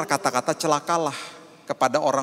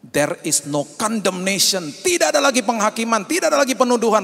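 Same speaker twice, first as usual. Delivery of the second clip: preaching proclamation; 8 semitones higher, 5 dB louder than usual. Acted. Preacher loudly proclaiming no condemnation to congregation. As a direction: proclaim it at full force.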